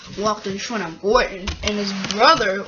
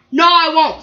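cross refusal, higher and louder; 5 semitones higher, 6 dB louder than usual. Acted. Gordon defies the controller's order, crossly. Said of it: "said Gordon crossly".

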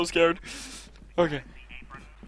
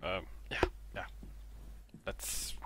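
whisper; 7 semitones lower, 10 dB quieter than usual. Real, not acted. Hiding quietly.

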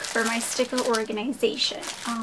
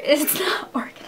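amused, breathy laughing admission; 4 semitones higher than usual. Real, not acted. Vlogger admitting her shelves are messy, laughing. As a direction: laugh through the words.

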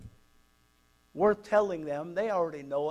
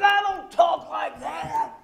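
slow, high, hammed-up indignation; mock protest; 9 semitones higher, 4 dB louder, slower than usual. Amy denying she taught the boy, mock indignant shout. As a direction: stretch out an exaggerated, high-pitched denial. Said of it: "She goes like this".

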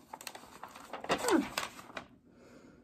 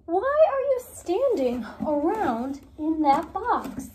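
usual speaking voice

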